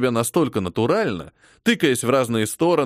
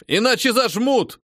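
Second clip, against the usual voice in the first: yelling; 9 semitones higher than usual. A narrator's reading. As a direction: shout the warning over gunfire. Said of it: "орал он на новобранцев". (сержант орёт предупреждение)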